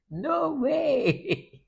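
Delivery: high, amazed exclaim of disbelief; astonished onlookers. Retold or imitated. Onlookers amazed at a toddler's loud crying, disbelieving. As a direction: gasp at how loud the child is. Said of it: "People go dey wonder".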